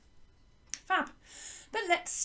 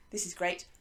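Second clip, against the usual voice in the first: ordinary delivery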